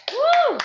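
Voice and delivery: very high, loud celebratory whoop; whooped "woo". Real, not acted. Coach celebrating the final squats with team, loud high whoop. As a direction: cheer with a big soaring "woo".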